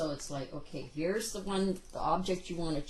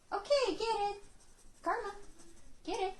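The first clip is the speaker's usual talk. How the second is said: much higher, cheerful get it; bright release cue; 13 semitones higher than usual. Real, not acted. Trainer releasing the dog to the treat, high and cheerful. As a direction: reward the dog with a happy release.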